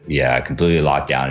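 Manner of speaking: ordinary talk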